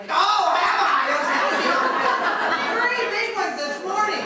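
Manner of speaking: high, loud giddy laugh through the answer. Retold or imitated. Drugged woman answering police, laughing. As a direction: giggle it to the officer.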